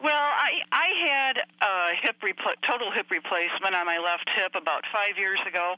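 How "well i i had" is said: rotary landline phone. Real, not acted. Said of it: "I'm talking to you on a landline".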